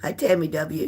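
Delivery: normal talking voice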